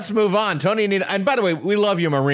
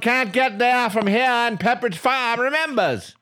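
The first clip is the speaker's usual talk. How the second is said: put-on higher-pitched old-commercial Maine accent; 6 semitones higher than usual. Shown as a demonstration. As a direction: lay on a hammed-up Maine accent. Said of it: "my main accent".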